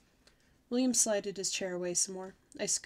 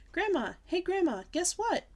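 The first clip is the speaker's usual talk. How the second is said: calling out; 10 semitones higher, 3 dB louder than usual. Acted. Child calling from her room.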